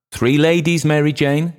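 high, urgent call from an aunt; high-pitched insistent summons. A narrator's reading. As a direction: raise the voice high and press the hostess. Aunt calls out for more dancers.

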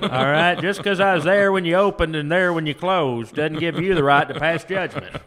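hammed-up Southern customer on a prank call; indignant barbecue customer. Prank caller arguing with an all-you-can-eat restaurant, indignant. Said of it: "call various North Carolina barbecue establishments".

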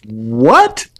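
high, loud stunned "what?"; can't believe what you just heard; hammed-up indignant rise; outraged disbelief. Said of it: "And we're like".

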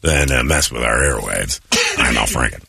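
low, deep mock announcement of being Al Franken. Host pranking the call with a deep fake Al Franken voice. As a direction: growl the name out like a prank intruder.

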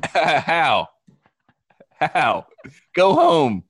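exasperated with a laugh breaking through; mock exasperation. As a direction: play up the disbelief, half laughing. Acts out everyone's exasperation at [name].

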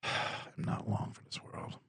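breathy whispered sigh, slow; hammed-up weary whisper. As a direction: whisper it like a dying man, slowly.

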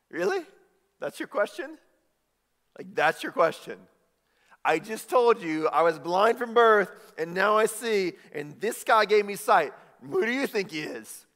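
high, loud, mocking disbelief; sarcastic kid. Healed kid mocking the Pharisees, sarcastic and loud. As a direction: scoff at the obvious question. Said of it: "he's so sarcastic".

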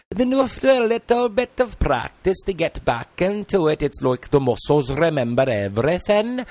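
lilting Welsh narration; Welsh accent. Shown as a demonstration. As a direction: carry the story in Welsh. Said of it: "Welsh dialect".

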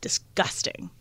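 whispered for dramatic effect; hushed disgust. Real, not acted. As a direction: drop to a low whisper on the reveal. Dramatic reveal of filthy room.

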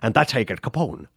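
a hint of Sean Connery's Scottish accent as Malone; Sean Connery impression. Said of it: "Sean Connery's so great as Malone".

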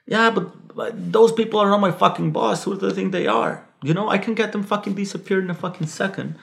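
imitating a furious contact; retold menacing rant. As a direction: voice the bully's hurt ego, heated. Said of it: "he was being violent and aggressive and menacing".

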